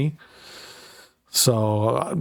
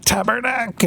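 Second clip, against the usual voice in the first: French Canadian outburst, pitched way up; French Canadian curse; 11 semitones higher than usual. Pretend. French Canadian tank gunner cursing as he fires. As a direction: blurt it like a Québécois soldier opening fire. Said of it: "If he was French Canadian".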